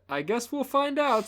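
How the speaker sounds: high mocking voice; mock her in a high voice; mocking [name] voice. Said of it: "[name] is just mocking her".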